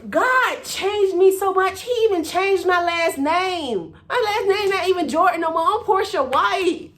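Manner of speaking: loud, high-pitched proclamation; exultant testimony. Real, not acted. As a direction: belt it out like a triumphant testimony. Exulting testimony about her changed name.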